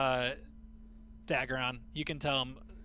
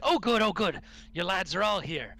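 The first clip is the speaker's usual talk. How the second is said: excited Gundren; 6 semitones higher, 6 dB louder than usual. Acted.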